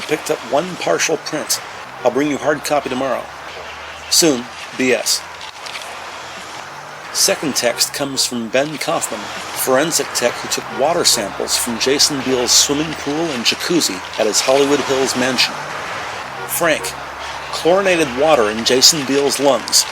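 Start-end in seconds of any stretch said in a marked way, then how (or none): none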